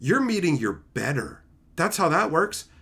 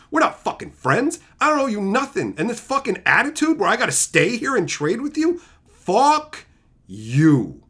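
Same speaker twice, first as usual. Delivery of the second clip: building to a loud higher shout; rising angry rant; 5 semitones higher, 4 dB louder than usual. Real, not acted. Ranting at a greedy trader, building to a shout. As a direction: rant louder until the final yell.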